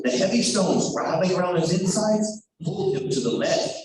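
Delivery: ordinary delivery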